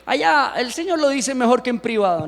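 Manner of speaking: ordinary talk, nothing marked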